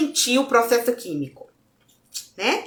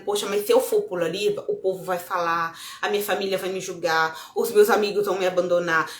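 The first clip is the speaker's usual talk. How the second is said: quiet worried inner voice; 8 semitones lower, 6 dB quieter than usual. Retold or imitated. Voicing fearful inner thoughts.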